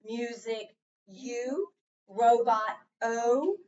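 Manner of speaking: slow, each letter drawn out with a rising tune; slow card reading. Shown as a demonstration. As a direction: read each card slowly, stretching every vowel name. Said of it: "let me go over the cards again just to remind you".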